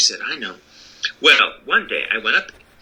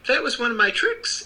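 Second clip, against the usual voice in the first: little man voice; 12 semitones higher than usual. Acted.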